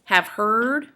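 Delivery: demonstrating an iamb, weight on "heard"; iamb, stress on HEARD. Shown as a demonstration. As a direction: lean hard on "heard" after "have". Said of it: "that's four iambs".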